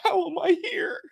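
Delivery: amazed. Retold or imitated. Amazed at the meal.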